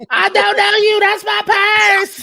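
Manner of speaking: high-pitched drawn-out shriek of indignation; high comic yell. Acted. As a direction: yell it high, stretching the last word. Shouted comic quote in a put-on voice.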